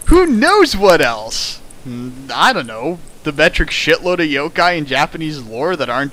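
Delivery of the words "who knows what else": slow, much higher, louder rhetorical question; mock dramatic wonder. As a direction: ham up the mystery.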